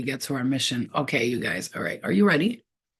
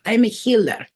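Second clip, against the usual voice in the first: higher and louder, proud and strong; powerful healer; 6 semitones higher, 6 dB louder than usual. Retold or imitated. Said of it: "She says it like that".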